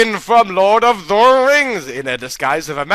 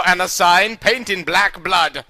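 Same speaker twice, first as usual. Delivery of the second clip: usual speaking voice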